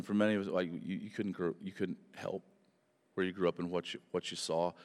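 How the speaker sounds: usual speaking voice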